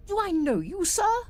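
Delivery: uneasy. A narrator's reading. Doctor questions Rook uneasily, a touch of unease. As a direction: probe nervously. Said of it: "the doctor asked uneasily".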